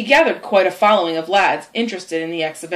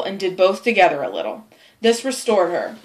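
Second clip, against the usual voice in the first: ordinary delivery, nothing marked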